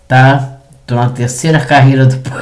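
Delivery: ordinary talk, nothing marked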